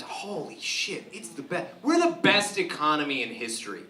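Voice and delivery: ordinary talk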